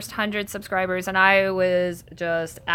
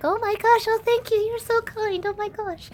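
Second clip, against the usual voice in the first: flustered compliment voice; 14 semitones higher than usual. Shown as a demonstration. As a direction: squeak out embarrassed thanks. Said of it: "I do voices where if people compliment me".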